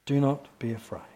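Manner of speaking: quiet, low echo; hushed reassurance; soft repeat. Shown as a demonstration. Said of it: "repeated for emphasis".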